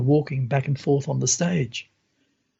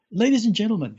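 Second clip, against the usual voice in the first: lightly voiced showman, pitched higher; announcer voice; 8 semitones higher, faster than usual. Magician presenting a volunteer to the crowd, mild announcer voice. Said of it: "he speaks through this microphone".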